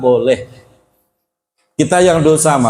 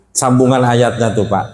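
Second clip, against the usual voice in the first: ordinary delivery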